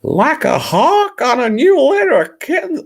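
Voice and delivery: very high, loud squealed disbelief; loud falsetto outrage; mock horror. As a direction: shriek it back as an appalled question. Mock horror at the line read.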